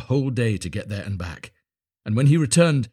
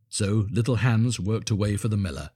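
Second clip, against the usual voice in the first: ordinary delivery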